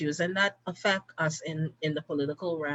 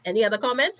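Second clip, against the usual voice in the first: ordinary talk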